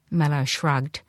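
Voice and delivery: plain reading voice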